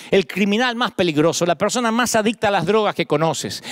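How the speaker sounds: ordinary talk, nothing marked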